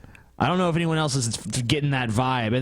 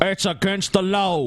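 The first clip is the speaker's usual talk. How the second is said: mocking impression; 4 semitones higher than usual. Mocking the creator's voice.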